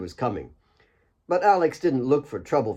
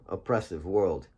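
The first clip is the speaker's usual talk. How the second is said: plain reading voice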